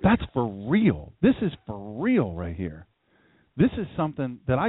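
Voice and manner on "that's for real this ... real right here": 1 hushed awe. Retold or imitated. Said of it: "catch your breath a little bit and say".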